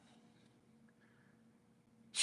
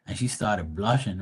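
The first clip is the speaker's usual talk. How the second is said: normal talking voice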